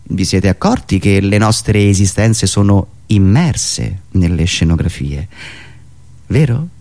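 usual speaking voice

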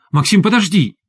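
high, urgent plea to wait; pleading call. A narrator's reading. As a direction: call after him, begging. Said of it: "Переход от ругани к мольбе".

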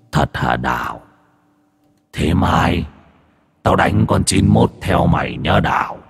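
low, slow ghostly voice; demon voice. Acted. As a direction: call out like a ghost from the trees. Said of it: "giọng ma quỷ thì vẫn như kia". (giọng ma quỷ trầm, chậm)

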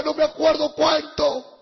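normal talking voice

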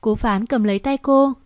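ordinary delivery

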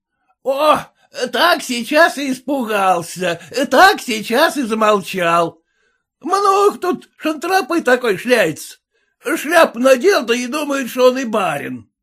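high, mocking peasant tirade; scornful, loud-mouthed peasant; jeering peasant. A narrator's reading. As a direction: taunt him at a high pitch.

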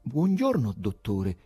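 high-pitched timid hello, falling away; nervous, deferential greeting. A narrator's reading. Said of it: "disse con timidezza".

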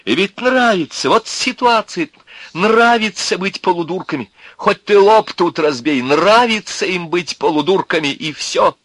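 high, loud exasperated abuse; ranting disbelief, pitched high; indignant tirade. A narrator's reading. Bound Князев abuses the crowd.

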